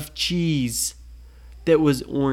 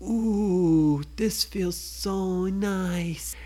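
slow, dreamy coo of pleasure; cooing delight; 4 semitones higher, much slower than usual. Acted. Girl in the story stroking velvet, cooing slowly. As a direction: savour the softness, slow.

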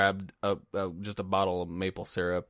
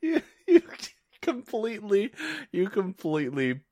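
high, squeaky start through real laughter; real laughter breaking up the sentence; laughing; 12 semitones higher than usual. Real, not acted. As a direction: struggle to get words out while laughing. Helpless laughter at co-host's failed venture.

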